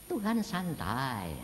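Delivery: ordinary delivery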